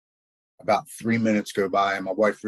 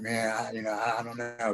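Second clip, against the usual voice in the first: softer, hesitant retold reply; reluctant; 5 dB quieter than usual. Man in truck wavering at friend's rehab plan, reluctant. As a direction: imitate an unsure, halting mumble of doubt.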